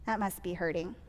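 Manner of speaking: soft, low sympathetic murmur; empathetic thought. Acted. Leader voicing her own thought about hurt Josie, quietly sympathetic. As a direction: think aloud gently, feeling for someone's pain. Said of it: "I think".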